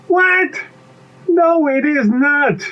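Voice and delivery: high, slow indignant denial; outraged disbelief. Acted. Bee refutes pea about honey, outraged. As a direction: shoot the pitch up and stretch the denial.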